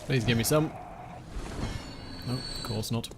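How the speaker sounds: ordinary talk, nothing marked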